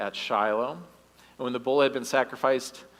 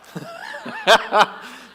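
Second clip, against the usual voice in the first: laugh; 9 dB louder than usual. Real, not acted. Laughing at own joke.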